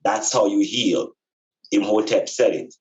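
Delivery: ordinary talk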